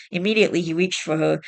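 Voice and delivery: ordinary delivery, nothing marked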